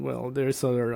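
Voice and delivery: normal talking voice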